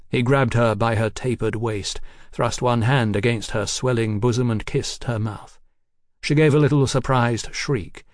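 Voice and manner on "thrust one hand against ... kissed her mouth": usual speaking voice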